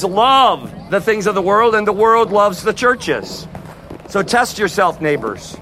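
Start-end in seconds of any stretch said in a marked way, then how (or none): none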